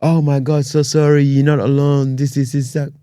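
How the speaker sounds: overdone "so sorry" commenter; mock sympathy. Mocking gushing online comments.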